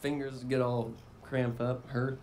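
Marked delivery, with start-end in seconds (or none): none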